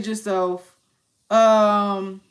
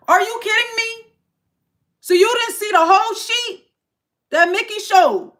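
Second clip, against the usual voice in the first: pitched up high, loud and fed up; can't believe what they're asking; exasperated disbelief; 12 semitones higher, 7 dB louder than usual. Real, not acted. Streamer ranting at chat's questions, exasperated.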